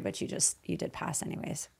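soft teasing whisper; whispered aside. Real, not acted. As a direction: whisper it half to yourself. Teasing aside after passing him.

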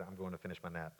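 very low, soft murmur; sleepy nonchalance. Acted. Jesus heading back to his nap, softly nonchalant. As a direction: toss it off quietly, unbothered.

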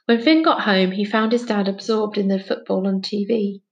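ordinary delivery, nothing marked

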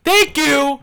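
high, loud vindicated thanks; emphatic thanks. Real, not acted. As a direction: crow it at the doubters. Retort after compliment.

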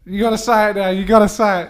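pressing a hesitant friend, loud and insistent; insistent urging. Real, not acted. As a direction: egg her on, loud and teasing. Friends pressing her to speak.

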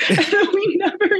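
words breaking up into laughter; laughing. Real, not acted. Friend recalling a phone call never followed up, laughing. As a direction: laugh through the words, pitch jumping high.

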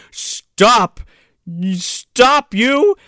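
high, loud mock command; loud, halting comic guard shout; guard calling out. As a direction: holler after someone running off, hammed up. Acted guard calling after runner.

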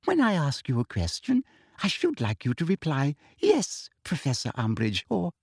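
sugary teacher voice. Acted. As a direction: lecture sweetly like a prim official.

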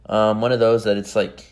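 normal talking voice

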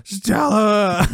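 high, loud drawn-out cry; imitated yell. Elaine yells out amid utter chaos, hammed-up holler. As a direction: holler the name as an impression.

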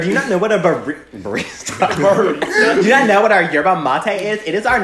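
high, sassy barista. Pretend. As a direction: play a snooty barista. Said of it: "You sound like a barista".

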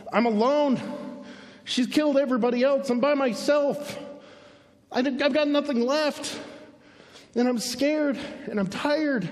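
high, plaintive Elijah; pleading, high-pitched complaint; self-pitying lament. Acted. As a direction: wail it as an acted pity party. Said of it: "Elijah goes back into his pity party".

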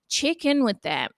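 normal talking voice

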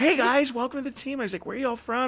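high, upbeat host voice; cheery welcome. Retold or imitated. Speaker welcoming new team members from the stage, high and bright. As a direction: greet new hires from the stage.

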